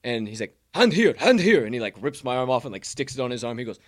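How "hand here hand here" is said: bellowing instructor. Retold or imitated. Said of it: "deep, bellowing voice".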